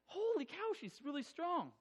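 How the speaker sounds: very high, squeezed, softened yelp; pained amazement. Retold or imitated. Husband gripped by wife in labour, high-pitched pained squeak. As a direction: squeak it out as if your hand is crushed.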